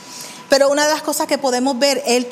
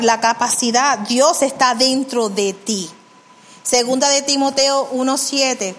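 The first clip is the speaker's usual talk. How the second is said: ordinary talk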